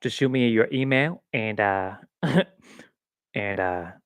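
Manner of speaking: put-on a loose, half-hearted stab at a celebrity accent; Matthew McConaughey. Vlogger mocking an Art of Living ad, bad celebrity accent. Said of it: "Matthew McConaughey".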